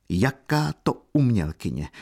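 plain reading voice

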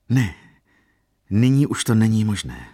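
ordinary delivery

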